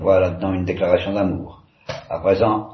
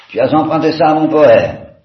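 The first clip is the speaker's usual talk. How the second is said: much higher and louder, elated; sudden exalted cry; 7 semitones higher, 9 dB louder than usual. A narrator's reading. Ivan cries out at his brother's kiss, exalted and loud. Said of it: "s'écria Ivan soudain exalté".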